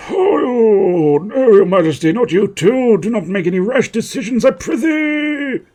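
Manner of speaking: high, loud pleading wail; alarmed courtier. Acted. Courtier begging His Majesty against rash decisions, wailing theatrically. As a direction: beg the monarch in a hammed-up high voice.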